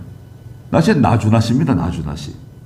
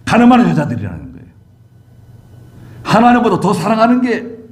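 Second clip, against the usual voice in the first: high, forceful pulpit delivery; emphatic high-pitched sermon proclamation; preaching; 9 semitones higher than usual. Real, not acted. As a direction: preach it high and hard, drawing out key phrases.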